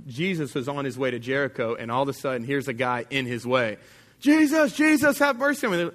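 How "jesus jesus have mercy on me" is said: high, urgent mock shout; yelling. Crazy guy in Jesus's way, yelling at him. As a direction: cry out high for mercy. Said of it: "just starts yelling at him".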